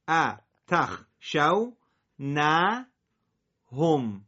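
slow split reading; syllable by syllable. Shown as a demonstration. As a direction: give each syllable its own beat. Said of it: "شما دوباره تکرار کن".

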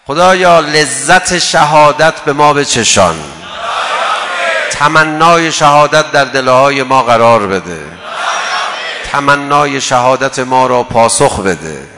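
slow, very loud pleading prayer; fervent supplication. Acted. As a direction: cry out to God for martyrdom. (مناجات پرشور و با صدای بلند)

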